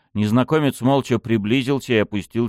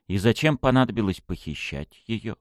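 usual speaking voice

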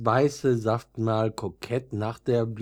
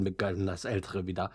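ordinary delivery, nothing marked

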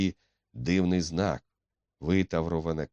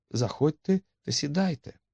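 much higher-pitched polite invitation; frightened young woman; 11 semitones higher than usual. A narrator's reading. Etti asks Baldwin to come in, high and nervous. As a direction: play a nervous hostess, voice lifted high.

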